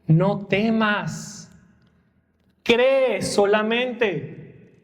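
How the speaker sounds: very slow, each word laid down gently; Jesus speaking slowly and tenderly to a grieving father; slow tender command. Acted. Jesus's words to Jairo.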